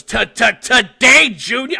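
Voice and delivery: loud, high, slow taunt; mocking stutter. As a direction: jab out the repeated first sound, mocking and impatient. Mocks a stutterer's slow speech.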